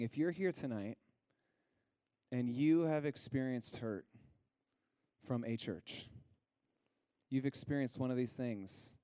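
normal talking voice

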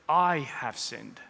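"I have sinned" very slow; slow confession. Shown as a demonstration. Son to his father, slow and deliberate. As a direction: weigh every word. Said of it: "he says".

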